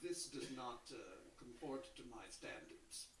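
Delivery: slow, lofty Mid-Atlantic drawl; lightly put-on Mid-Atlantic airs, mocking a friend; a hint of Thurston Howell Mid-Atlantic snobbery; mock Mid-Atlantic accent. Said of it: "make fun of the way you talk".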